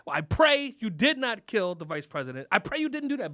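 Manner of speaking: ordinary talk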